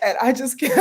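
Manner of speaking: words dissolving into a high giggle; giggling. Real, not acted. Woman telling viewers her story, breaking into giggles. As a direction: lose it to the giggles. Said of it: "ooh, that made me giggle".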